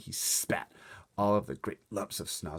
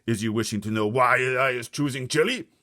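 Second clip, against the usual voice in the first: louder, higher bragging giant voice; boasting giant; 7 semitones higher, 14 dB louder than usual. Acted. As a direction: gloat over tonight's meal. Bloodbottler boasts.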